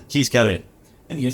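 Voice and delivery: ordinary talk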